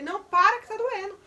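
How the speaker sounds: loud, high cry of pain, retold; a pained shout relived in the telling; retold scream. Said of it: "mas nessa hora eu consegui força e gritei".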